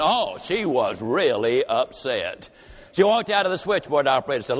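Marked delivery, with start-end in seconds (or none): 0.0-2.5 very slow, low "Oh, she was really upset"; deep, slow refrain. A narrator's reading. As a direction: stretch each word, low.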